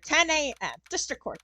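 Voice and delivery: ordinary talk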